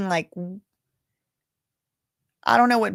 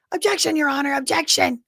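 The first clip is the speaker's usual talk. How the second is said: mock objection; 8 semitones higher than usual. Feds objecting in retold trial.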